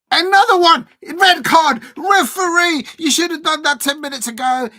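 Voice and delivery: yelling red card at the screen, high and loud; full-throated outraged shout at the referee; angry shouting. Real, not acted. Furious at foul, yelling at screen.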